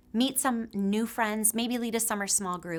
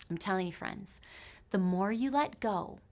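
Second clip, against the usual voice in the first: normal talking voice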